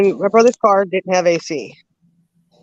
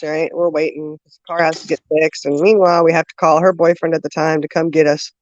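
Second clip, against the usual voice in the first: normal talking voice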